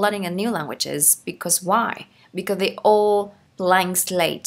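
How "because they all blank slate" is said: 1 slow model reading. Shown as a demonstration. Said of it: "teacher reads phrase slowly".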